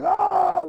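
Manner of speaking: high excited held yell; excited yelp. Retold or imitated. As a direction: let out a thrilled cry. Retold excited yell in game.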